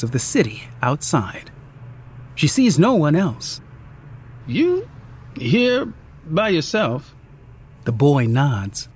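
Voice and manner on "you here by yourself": a lightly voiced waitress, slow, higher; gentle concerned waitress question; friendly waitress. A narrator's reading.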